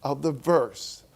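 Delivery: ordinary talk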